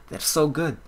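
fond, enthusiastic praise; gushing praise. Real, not acted. Gushing over vampires, first take.

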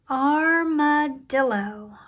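"Armadillo" drawn out, each syllable audible; slow clear demo. Shown as a demonstration. Teacher presents a long word, "Armadillo" said slowly. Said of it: "That's a long word".

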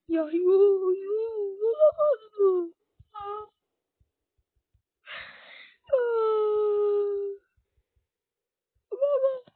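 very high, drawn-out wailing; words stretched into loud sobs; sobbing. Real, not acted. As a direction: cry out loudly in disbelief and plead. Sobbing over bad game luck.

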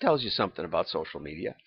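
ordinary talk

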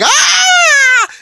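very high, loud shrieking cry; Babidi's scream. Retold or imitated. Babidi shrieking as the power hits, very high scream. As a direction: shriek in sudden terror. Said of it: "Babidi is like".

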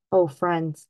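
ordinary delivery

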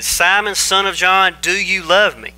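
emphatic, searching repeat of a question; insistent third asking. Acted. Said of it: "And a third time he asked him".

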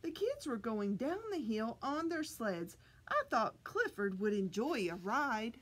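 plain reading voice

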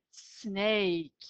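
Snake with a drawn hissing s; hissy snake. Shown as a demonstration. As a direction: stretch the s in Snake like a hiss. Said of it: "sanki tıslama gibi bir ses çıkıyor".

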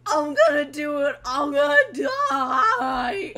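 high, loud, slow despairing wail; hammed-up despair. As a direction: wail like a doomed man. Voicing defendant's despair.

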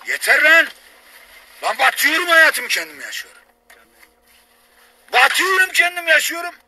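yelling high and loud in anger; angry shouting. Real, not acted. Drunk rant, yelling at family.

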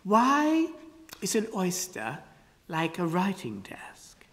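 slow, pondering, sinking to a whisper; musingly. Acted. Ada muses over the riddle, fading to a whisper. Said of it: "She said musingly".

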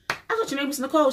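high, fast mock surprise; surprised question. She asks her sister about her real name, high and quick. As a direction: squeak it out quickly in disbelief.

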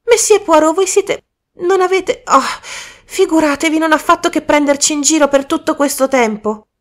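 high, loud excited outburst; shouting. Acted. Michael shouts at Poirot, realizing he fooled them all. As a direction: splutter it out at full volume. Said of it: "gridò Michael".

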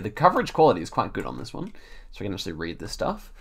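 a little breathless while talking; short of breath. Real, not acted. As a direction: speak as if slightly winded. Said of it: "I'm a bit short of breath today".

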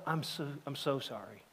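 a grieving father apologizing, softly; softly retold apology. Grieving father on the bus apologizing, hushed. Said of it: "I'll go".

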